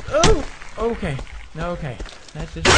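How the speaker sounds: ordinary talk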